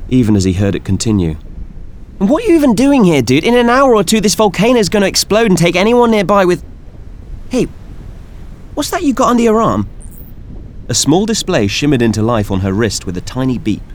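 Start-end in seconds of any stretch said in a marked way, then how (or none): 2.2-9.9 high-pitched nasal voice, fast and eager; a chirpy young scientist, much higher; high nasal young woman. Acted. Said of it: "a high-pitched nasal voice chimed in".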